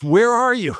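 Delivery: high, loud alarmed shout; frantic manager. Retold or imitated. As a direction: call out in a panic to find her. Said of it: "my manager's like".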